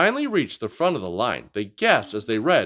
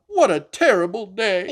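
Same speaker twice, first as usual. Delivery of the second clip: moaning; 7 semitones higher, 4 dB louder than usual. Acted. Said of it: "Anda moaned on".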